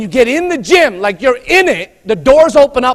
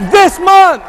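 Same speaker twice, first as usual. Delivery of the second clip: "This month" shouted, high and loud; crowd-rousing shout of "This month"; 4 semitones higher, 5 dB louder than usual. Shown as a demonstration.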